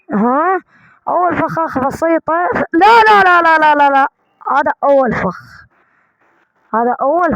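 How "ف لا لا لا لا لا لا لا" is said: rapid loud panicked noes. Real, not acted. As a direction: cry out no no as it goes wrong. Trap triggers, panicked denial.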